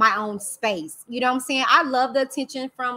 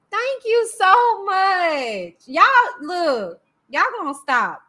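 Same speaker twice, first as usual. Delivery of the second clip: slow, drawn out, higher and louder thanks; touched thanks; 6 semitones higher, 4 dB louder, slower than usual. Real, not acted. Thanking a viewer for kind words, drawn out gratefully. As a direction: stretch it out, truly touched.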